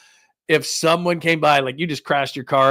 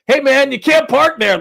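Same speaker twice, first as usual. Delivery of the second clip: loud, high drive-by heckle; mock yell; 12 semitones higher, 7 dB louder than usual. Somebody comes by your crash, mock yelling. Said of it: "somebody come by and yell at you".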